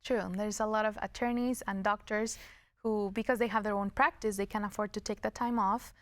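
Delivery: ordinary delivery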